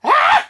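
piercing, very high, loud shriek; shrill scream. Acted. Misses Crabone shrieking at Katrina in rage. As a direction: let out a shrill cry of rage. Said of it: "stieß einen Schrei aus, ganz grell".

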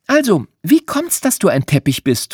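put-on high, bright girl asking a bear; a young girl's high, curious question. A narrator's reading. Girl Madeline asks bear.

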